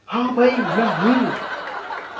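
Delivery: hammed-up boastful yes, chest puffed out; proud dumb friend. Friend proudly agrees in canteen.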